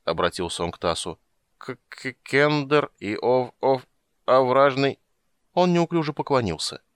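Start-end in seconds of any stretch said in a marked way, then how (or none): 1.6-5.0 put-on slow, stumbling drunk stutter; slurred drunken stammering. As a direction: trip over the words like a drunk. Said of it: "с дурацкой улыбкой".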